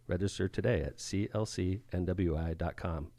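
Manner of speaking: low, soft seductive read; sexy voice. Acted. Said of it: "your sexy voice".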